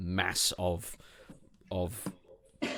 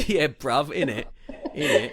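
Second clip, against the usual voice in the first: mock London accent; 6 semitones higher, 6 dB louder than usual. Joking British slang voice.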